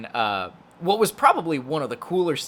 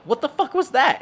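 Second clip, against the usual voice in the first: much higher, louder disbelief; stunned hosts; 11 semitones higher, 4 dB louder than usual. Retold or imitated. Hosts reacting to the hypnotism trick, high shocked disbelief. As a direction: gasp out the question in shock. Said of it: "they're all like".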